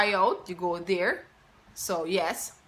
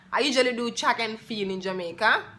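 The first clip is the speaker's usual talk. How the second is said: ordinary talk, nothing marked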